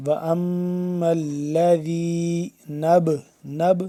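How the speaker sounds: slow chant ending in a bounced ب on نَبْ; drawn-out recitation, qalqala on نَبْ. Shown as a demonstration. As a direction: release the b of نَبْ with a short echo. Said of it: "قلقلہ کر کے پڑھیں گے".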